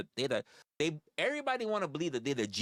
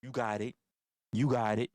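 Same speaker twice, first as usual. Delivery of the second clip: backing down; 7 semitones lower than usual. Retold or imitated. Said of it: "and they're like".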